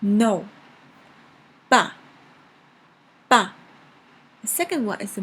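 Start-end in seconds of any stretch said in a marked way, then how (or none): 0.0-3.5 "No" and "Ba" punched out and falling sharply; fourth tone. Shown as a demonstration. Teacher practising the word for dad, sharp falling "Ba". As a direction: drop "Ba" firmly, like an emphatic "No". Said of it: "is the fourth tone".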